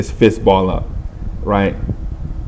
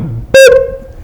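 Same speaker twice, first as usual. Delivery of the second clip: high-pitched "boop"; short high mock impact noise; imitated punch sound; 14 semitones higher, 3 dB louder than usual. Retelling a punch to the eye, high boop.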